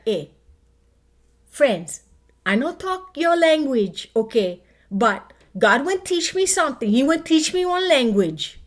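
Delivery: Hawaiian Pidgin, animated and loud; Pidgin accent. Pretend. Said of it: "say something in Pidgin".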